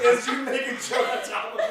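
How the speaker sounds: hysterical laughter. Real, not acted.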